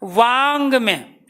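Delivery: वांग में drawn out slowly, each sound spelled out; teacher slows वांग में to show the nasal; slow demo. Shown as a demonstration. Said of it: "जैसे शब्द आ गया". (वांग में को बहुत धीरे बोलकर समझाना)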